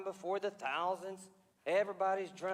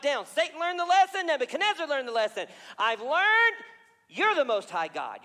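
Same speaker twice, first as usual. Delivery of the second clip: higher, loud proud boast turning to prayer; Nebuchadnezzar's loud boast then humble confession; boastful king to humbled king; 5 semitones higher, 7 dB louder than usual. Acted. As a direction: play the proud king, then let him bow.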